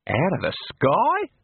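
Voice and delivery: slow, rising disbelief; drawn-out astonished question; incredulous question. A narrator's reading. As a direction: ask it in wonder, pitch climbing high. Character astonished that water falls.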